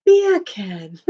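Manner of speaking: imitated British "Beer can", R dropped; British "Beer can" passing for Jamaican "bacon"; British accent. Streamer reenacts first trying the joke, British accent. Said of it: "immediately started going".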